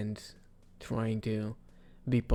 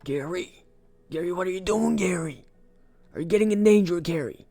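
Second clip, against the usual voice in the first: calling out; 8 semitones higher, 8 dB louder than usual. Pretend. Calling out to companion Gary.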